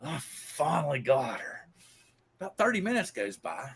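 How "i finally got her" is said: slow, drawn-out soft triumph; proud, savoring gloat. Acted. Boy proud after beating girl, slow savoring gloat. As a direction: stretch it out, relishing the win. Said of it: "He's proud of me".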